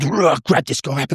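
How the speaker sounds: slow, high-pitched growling roar; beast mode. Acted. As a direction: roar like a beast as you grab him. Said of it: "I go into beast mode".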